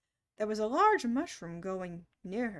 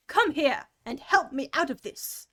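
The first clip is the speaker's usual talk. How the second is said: angry; 3 semitones higher, 8 dB louder than usual. A narrator's reading. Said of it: "said the rabbit angrily".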